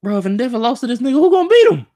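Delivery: shocked shouting. Real, not acted. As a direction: voice shooting very high and loud in disbelief. Reacting to hero being beaten.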